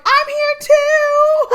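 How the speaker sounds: high, loud, stretched out yell across the field; excited shout. Retold or imitated. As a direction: call out as you sprint toward the tent. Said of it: "I was like".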